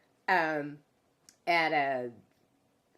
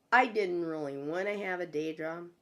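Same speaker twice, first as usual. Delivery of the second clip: ordinary delivery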